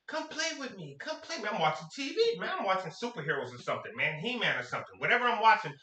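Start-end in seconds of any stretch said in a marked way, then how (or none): 0.1-1.5 put-on high, soft little-girl pleading; a light imitation of a nagging little girl; whiny little sister. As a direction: beg like a small kid sister. Little sister begging him to play.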